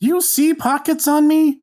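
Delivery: high, incredulous retort; indignant. Real, not acted. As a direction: fire back in disbelief. Hero snapping back at the damsel.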